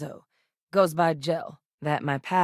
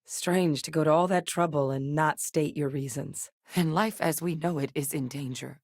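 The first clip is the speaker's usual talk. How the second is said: ordinary talk, nothing marked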